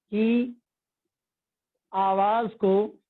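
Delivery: slow dictation with long pauses between the words; dictation pace. Shown as a demonstration. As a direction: spell it out word by word for students to write. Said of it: "اخفا کی تعریف". (طلبہ کو لکھوانے کے لیے آہستہ آہستہ)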